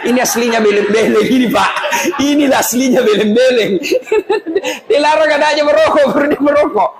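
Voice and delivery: laughing high through the words; giggling at the hypocrisy. Real, not acted. (sambil tertawa geli)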